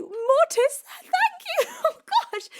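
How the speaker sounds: very high delighted shriek; excited scream. Real, not acted. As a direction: squeal with joy, loud and high. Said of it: "Did you hear that? I went really high then".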